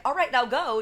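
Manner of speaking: lightly voiced brisk godmother; voiced fairy godmother. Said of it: "She's like".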